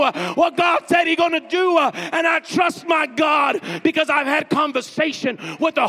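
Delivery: loud, high shouting preacher; shouting preacher rousing the church; preaching at full force. Acted.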